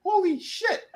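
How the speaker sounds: very high-pitched amazed exclamation; mock awe. Recapper as man finding his hand back, mock awe. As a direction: squeak it in wonder.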